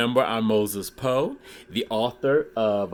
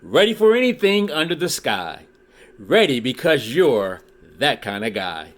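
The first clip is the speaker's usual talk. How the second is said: lively read-aloud with rhyming bounce; storybook reading; 3 semitones higher than usual. Acted. Reading a Dr. Seuss book to his YouTube viewers, lively storytelling. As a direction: read it like a children's picture book.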